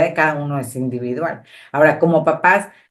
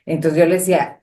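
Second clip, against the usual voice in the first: normal talking voice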